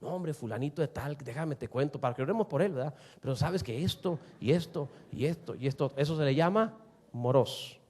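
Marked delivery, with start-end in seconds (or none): 0.0-5.9 low, soft confidential gossip; mock hushed tattling. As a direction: pass it on as if in confidence. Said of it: "chisme contra tu prójimo".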